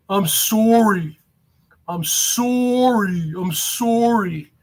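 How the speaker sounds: drawn-out Canadian "sorry"; mocking Canadian accent. Streamer mimicking a rival's apology, mocking Canadian accent. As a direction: stretch SORRY slowly in a put-on Canadian accent. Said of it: "his goddamn Canadian accent".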